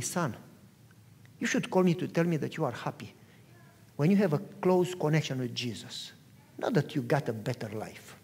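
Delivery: low, soft, sober correction; acting out a calm, serious father; gentle fatherly rebuke. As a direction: correct a son softly, pitch dropped. Father gently corrects excited son.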